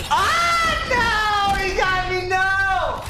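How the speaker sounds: loud, very high-pitched scream; drawn-out high shriek of gamer despair; screaming in defeat. Real, not acted. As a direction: wail "no" in anguish at being beaten. Screams when caught in game.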